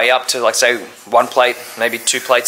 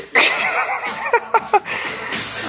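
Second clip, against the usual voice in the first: high breathy laugh; 5 dB quieter than usual. Real, not acted. Laughing between sets.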